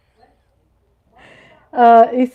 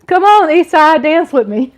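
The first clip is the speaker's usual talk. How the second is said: playful call to dance; 5 semitones higher, 8 dB louder than usual. Pretend. Calling a viewer to dance.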